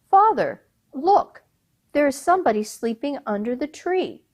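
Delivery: excited call. Acted. Son calls out to father.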